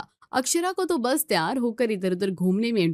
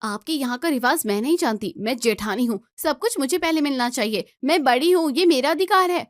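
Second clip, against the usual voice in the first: demanding; 4 semitones higher than usual. Acted. Bride demands her right.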